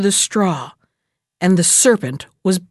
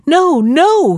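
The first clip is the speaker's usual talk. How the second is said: mother's urgent call, raised and louder; calling after him; 7 semitones higher, 5 dB louder than usual. A narrator's reading. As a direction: call out higher and louder to stop a child. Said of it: "his mother called after him".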